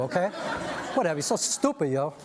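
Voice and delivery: normal talking voice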